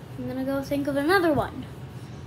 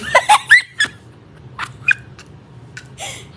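very high, loud giggly puppet voice; Elmo; 14 semitones higher, 5 dB louder, faster than usual. Acted. Kid impersonates a puppet for the camera, high and giggly. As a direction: squeal it like an excited puppet. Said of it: "doing weird impressions".

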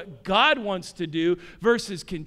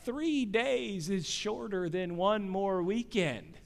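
softer, slow explaining; dad gently explaining days to son, softly; patient dad; 8 dB quieter, slower than usual. Retold or imitated. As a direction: calmly reason with a small child. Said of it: "And I said".

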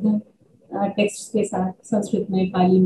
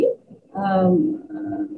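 normal talking voice

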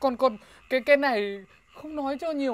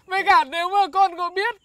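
ordinary talk

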